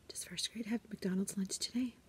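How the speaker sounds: very soft, strained whisper; holding pain in. Retold or imitated. Teacher asking principal after hitting her nose, pained whisper. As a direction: whisper it while holding back tears. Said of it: "Trying to hold it all in".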